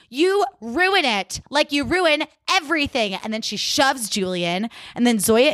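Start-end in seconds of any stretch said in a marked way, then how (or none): 0.1-3.1 acted accusation. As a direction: stretch it out as a dramatic accusation. Monet accuses Julian, then shoves her.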